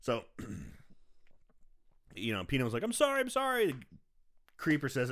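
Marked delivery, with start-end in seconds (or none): 2.8-3.7 high, hurried-sounding mock apology; mock pleading apology. Son apologizing to angry father.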